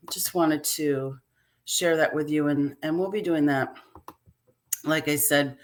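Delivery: ordinary delivery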